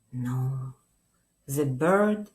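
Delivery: ordinary delivery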